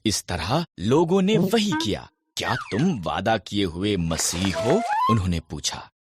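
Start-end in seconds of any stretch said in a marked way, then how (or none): none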